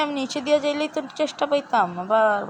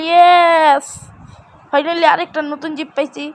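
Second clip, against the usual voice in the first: loud, higher triumphant yes; excited yes; 4 semitones higher, 8 dB louder, slower than usual. Real, not acted. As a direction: cheer it out loud. Finds a crocodile. (জোরে উল্লাসে হ্যাঁ)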